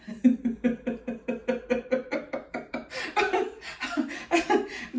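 airy, stuttering laughter; real drawn-out laugh; long giggling fit. Real, not acted. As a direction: keep giggling helplessly. Long laughing fit before sign-off.

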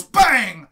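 loud shouted bang falling in pitch; mimed gunshot. Retold or imitated. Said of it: "points his empty gun at an orc and goes".

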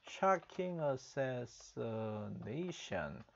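"Shocking assassination" drawn out very slowly; slow repeat. Shown as a demonstration. Teacher slowly repeating the anchor's words for the class. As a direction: stretch each syllable of "Shocking assassination". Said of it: "repeating the news line slowly".

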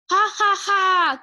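slow, loud, high "ha ha ha"; triumphant mock laugh. Clay gloats after beating the queen, triumphant mock laugh. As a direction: crow a spelt-out villain laugh. Pretend villain gloats over victory.

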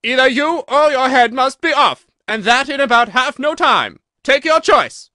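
a queen screaming a threat; furious shout. A narrator's reading. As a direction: roar the warning at the Duchess. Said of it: "shouted the Queen".

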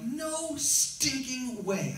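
very slow, high, each word hammered; Paul's refusal, stretched and pushed hard; emphatic refusal. Retold or imitated. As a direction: drag out "No stinking way" with full force. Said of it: "he is so emphatic when he says that".

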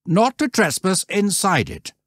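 stern scoutmaster. A narrator's reading. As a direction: lay down the rule to the troop.

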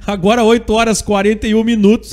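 usual speaking voice

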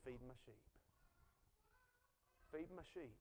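Jesus gently repeating his charge; gentle reply. Retold or imitated. Jesus answers Peter each time.

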